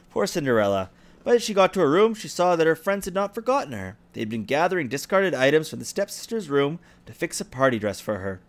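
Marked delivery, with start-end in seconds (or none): none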